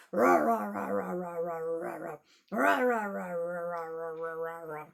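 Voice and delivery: low rah growls; growling demo. Shown as a demonstration. Storyteller showing kids how tigers growl, low. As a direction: growl for the children to copy. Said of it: "Can you growl like the tigers".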